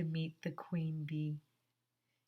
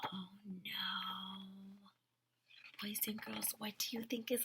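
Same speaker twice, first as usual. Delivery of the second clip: whisper; 2 semitones higher, 10 dB quieter than usual. Acted. Whispered dismay at the sleep.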